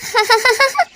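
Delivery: high delighted giggle; happy giggle. A narrator's reading. Gauri laughing with joy to Kalu, a high giggle. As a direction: let a giddy laugh bubble up high. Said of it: "Gauri हंसते हुए बोलती है".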